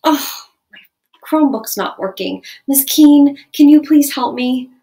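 calm polite request to the teacher; talking tone of voice. Shown as a demonstration. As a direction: ask nicely for help, no edge. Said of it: "what a talking tone of voice might look like".

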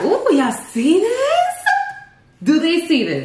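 squealing way up high with delight; high excited squeal. Real, not acted. Excited over the food.

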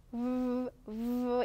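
"우" as a held buzzing vvv, teeth on lip; V letter sound. Shown as a demonstration. Teacher showing V unlike B, "우" as lip-on-teeth vvv. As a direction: bite the lip and hum "우" as vvv. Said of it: "이빨을 입술에 물고".

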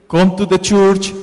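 normal talking voice

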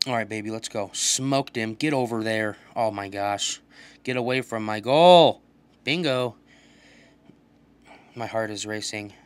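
ordinary talk, nothing marked

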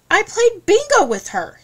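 high, loud, indignant protest; defensive. Acted. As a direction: insist hotly, voice jumping up. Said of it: "he said defensively".

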